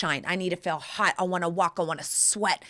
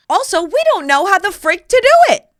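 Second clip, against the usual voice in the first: exasperated burst; 10 semitones higher, 10 dB louder, faster than usual. Real, not acted. Outburst after whisper.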